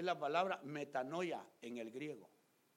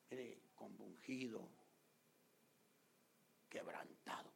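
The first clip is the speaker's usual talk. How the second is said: slow, softer, whispered remorse; remorseful hush; 5 semitones lower, 10 dB quieter, slower than usual. Retold or imitated. Pastor recalling his baptism, hushed and contrite. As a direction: drop to a broken whisper. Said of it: "Yo bajé, hermano, llorando".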